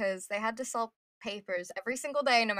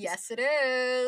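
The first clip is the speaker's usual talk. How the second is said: slow, put-on Brooklyn accent; lightly voiced Brooklyn accent; 4 dB louder, much slower than usual. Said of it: "I don't do a good Brooklyn accent either".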